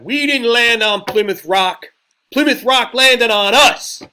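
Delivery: fiery rally speech, very high and loud; Malcolm X. Retold or imitated. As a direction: drive it home slowly to a big crowd. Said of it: "one of his lines from one of his speeches was".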